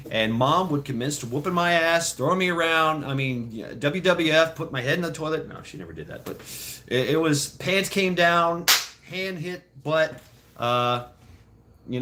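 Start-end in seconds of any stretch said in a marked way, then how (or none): none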